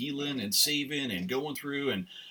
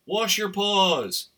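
emphatic plea; 5 semitones higher, 7 dB louder, much slower than usual. Real, not acted. Pleading audience to wash hands.

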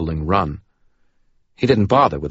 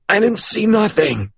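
panting denial; 3 dB louder than usual. Acted. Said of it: "he said a few panting seconds later".

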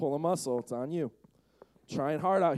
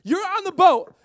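high, loud build-up; loud, excited; 13 semitones higher, 7 dB louder than usual. Shown as a demonstration. Pastor setting up the ghost sighting, loud and excited. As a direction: crank up the excitement. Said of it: "This is where I get loud, get excited".

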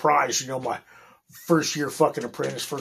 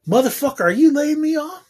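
higher, rising outburst of disbelief; retold disbelief; 10 semitones higher, 4 dB louder than usual. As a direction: reenact the shock of hearing the news. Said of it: "I was like".

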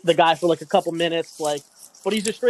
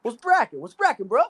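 a hint of a street greeting, higher; imitating the passenger; 6 semitones higher than usual. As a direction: lightly put on the passenger's voice. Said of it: "And he goes".

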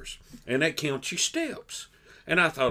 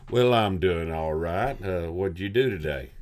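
bass singer greeting; 8 semitones lower than usual. Shown as a demonstration. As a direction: sink down into your low bass register. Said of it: "we're gonna show you how that goes".

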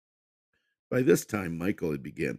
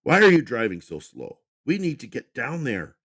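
irritated; 8 semitones higher, 7 dB louder than usual. A narrator's reading. Husband scolding her driving.

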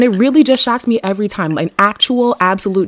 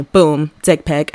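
usual speaking voice

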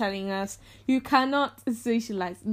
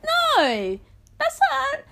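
outraged; 5 dB louder, much slower than usual. Real, not acted. Outraged disbelief.

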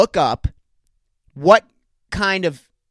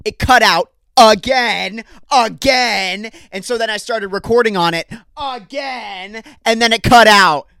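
exasperated; 5 semitones higher than usual. Real, not acted. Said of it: "I hate that recording software".

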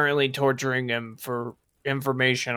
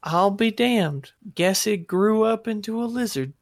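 amazed; 8 semitones higher than usual. Acted. Amazed NPC remark.

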